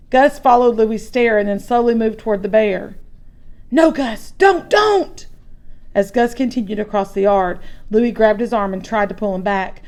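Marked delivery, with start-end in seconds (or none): 3.7-5.2 frightened plea. Acted. Boy begging brother to stop.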